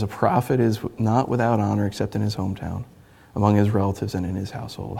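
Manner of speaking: soft, sorrowful quote; quiet lament. Acted. Jesus speaking to his hometown, soft and hurt. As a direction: let the hurt show gently.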